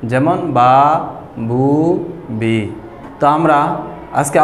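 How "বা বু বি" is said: বা, বু, বি each with the vowel drawn out; long vowels. Shown as a demonstration. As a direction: hold the vowels of বা, বু, বি one alif long. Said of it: "ডান দিকে হরকতকে এক আলিফ টানিয়া পড়িতে হয়".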